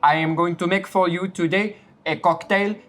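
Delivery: a hammed-up French accent, zis for this. Little French boy mixing a sponsor cocktail, in a hammed-up French accent. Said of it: "Little French boy, take it over".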